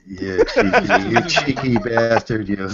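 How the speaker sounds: high, drawn-out teasing through laughter; laughing banter. Real, not acted. Teasing a friend over his cheap jokes, laughing. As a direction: laugh through the playful insult.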